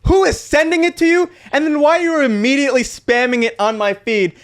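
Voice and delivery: high, incredulous whine; mock disbelief at the spam; exasperated quote. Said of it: "You're like".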